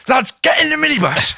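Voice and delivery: high, loud call to the lads; re-enacted shouted order; retold urgent call. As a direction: call your mates in urgently, pitch right up. Retold calling out to his mates.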